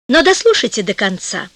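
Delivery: usual speaking voice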